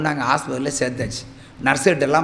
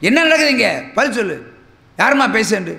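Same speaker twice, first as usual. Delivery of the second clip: stern demand; 6 semitones higher, 3 dB louder than usual. Retold or imitated. Doctor demanding answers.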